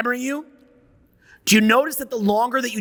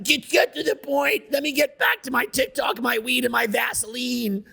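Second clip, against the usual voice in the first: mocking, higher-pitched griping; whiny impatient complainer; 4 semitones higher than usual. Speaker imitating a viewer who wants his TikTok back, whiny impatient complainer. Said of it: "And you're like".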